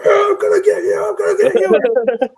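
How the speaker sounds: very high, loud wailing threat; retold yelling threat. Homeowner threatening whoever is crashing downstairs, high wild yell. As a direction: re-enact a wild yell at an intruder. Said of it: "And I'm yelling".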